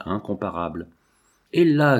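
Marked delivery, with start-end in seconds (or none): 1.5-2.0 louder, higher jovial call; cheerful shout. A narrator's reading. Old scientist at a joyful breakfast, merry loud call. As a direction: call out merrily, without laughing. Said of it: "criait Langres en riant".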